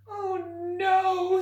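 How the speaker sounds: high, loud wail of dismay; dismayed cry. A narrator's reading. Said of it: "said George".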